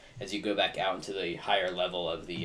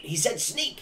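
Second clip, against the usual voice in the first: very high squeaky goblin, rising on Sneak; a goblin urgently reminding the others; excited goblin; 12 semitones higher than usual. Acted. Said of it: "he goes".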